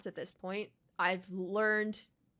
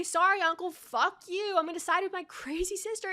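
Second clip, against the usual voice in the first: high, sneering mock quote; bratty mimicry of the prince; mocking Zuko; 8 semitones higher, 3 dB louder than usual. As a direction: throw his words back at him. Mocking Zuko's words.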